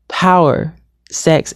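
normal talking voice

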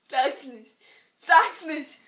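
loud urgent plea. Real, not acted. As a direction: beg loudly.

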